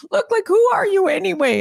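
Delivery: mock frustration; frustrated retort. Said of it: "he got really frustrated with me".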